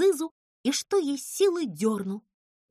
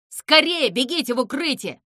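loud, urgent order; raised, pressing alarm call; shouted warning; 4 semitones higher, 8 dB louder than usual. A narrator's reading. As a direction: call out to everyone to run. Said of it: "крикнул кот".

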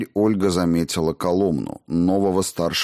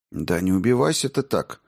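plain reading voice

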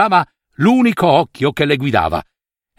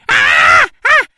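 urlo acuto di orrore; grido inorridito; 5 dB louder than usual. Acted. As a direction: strilla inorridita. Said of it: "gridò la nutria bianca". (urlo di spavento della nutria)

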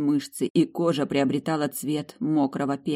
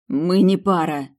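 raised, slow, emphatic retort; angry spitting; 4 semitones higher, 5 dB louder than usual. A narrator's reading. Heroine denying they are a couple, angrily. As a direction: spit out the denial. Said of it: "зло сплюнула".

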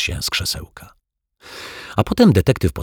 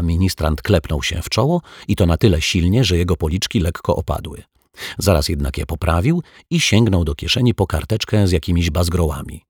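plain reading voice

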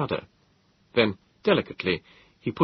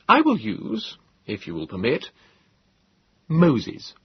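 firm naming; 6 semitones higher, slower than usual. Acted. Narrator names host.

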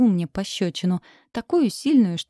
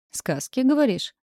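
scoffing; 6 semitones higher than usual. Acted. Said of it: "хмыкнул шеф".

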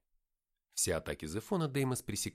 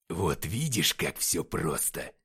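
low, slow growled gloat; low, unhurried muttering with weight; muttering victor; 4 semitones lower, 5 dB louder, slower than usual. A narrator's reading. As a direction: gloat slowly in a low, heavy voice. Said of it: "пробормотал Деймос".

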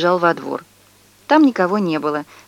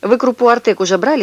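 brisk, louder businesslike question; 5 semitones higher, 4 dB louder than usual. A narrator's reading. Neighbour briskly asks mother about cereal. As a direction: ask like a busy shopper. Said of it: "спросила она деловито".

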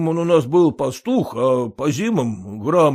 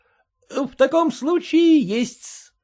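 slow, higher, a little embarrassed clerk; hesitant clerk; 13 semitones higher, 4 dB louder, slower than usual. A narrator's reading. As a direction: drag it out, reluctant to admit. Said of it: "потупясь дал ответ приказчик".

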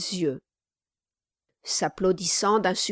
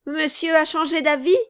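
feigned offence; 7 semitones higher, 5 dB louder, faster than usual. A narrator's reading. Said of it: "feignant de se lever de table".

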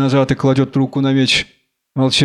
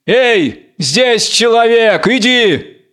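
higher, drawn-out call; 8 semitones higher, much slower than usual. Acted. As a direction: hail the beast from afar, a restrained shout. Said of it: "Кричит". (протяжный окрик, повыше)

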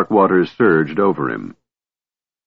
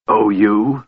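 a lightly voiced, disbelieving echo; incredulous; 2 semitones higher than usual. A narrator's reading. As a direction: toss the words back in disbelief. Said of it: "sucked in a hissing breath".

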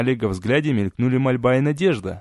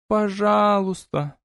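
slow, high, naively sly plea; drawn-out childish please; 8 semitones higher, much slower than usual. A narrator's reading. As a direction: stretch it out with childish cunning. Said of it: "протянула с такой наивной детской хитростью".

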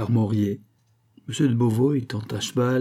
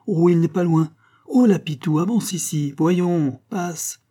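high, raised voice hailing someone; calling out; 7 semitones higher, 4 dB louder than usual. A narrator's reading. The farmer calls Pitou over to Gilbert, calling out. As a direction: call him over through a crowd.

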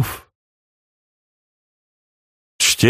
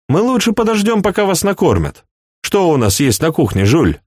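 high prince voice; 10 semitones higher than usual. A narrator's reading.

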